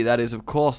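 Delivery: normal talking voice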